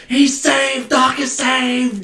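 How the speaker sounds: put-on kid's delighted shout of relief; excited. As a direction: cheer it like a thrilled kid. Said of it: "I got so excited".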